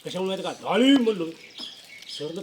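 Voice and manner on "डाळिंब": said loudly. Retold or imitated. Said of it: "एवढं जोरात म्हणले".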